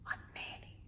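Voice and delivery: reverent whisper; hushed reveal. Real, not acted. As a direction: whisper it like a precious secret.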